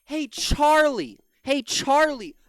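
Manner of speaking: loud, high calls to Charlie, slow at times; calling out. Real, not acted. Streamer calling out to pundit, loud mocking hail. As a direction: shout his name across the room to get his attention.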